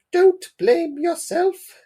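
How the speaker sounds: slow, loud, very high falsetto; put-on falsetto mum, drawn out. Mum reassures Dad, slow high falsetto mum voice. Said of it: "I was doing a voice for the mum".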